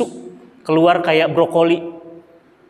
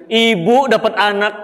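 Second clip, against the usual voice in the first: normal talking voice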